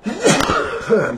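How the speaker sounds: coughing fit. Real, not acted. Asthma cough mid sentence.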